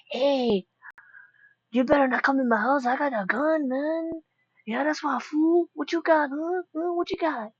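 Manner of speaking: hammed-up Mexican accent threatening an intruder. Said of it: "I did a Mexican voice for him".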